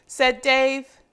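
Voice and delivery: put-on lively read-aloud for young children; storybook reading. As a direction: read it like a picture book to little kids. Reading picture book to children.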